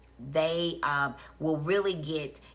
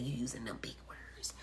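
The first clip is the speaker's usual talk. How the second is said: whispered quote; 4 semitones lower, 14 dB quieter than usual. Retold or imitated. Quoting mama in a whisper.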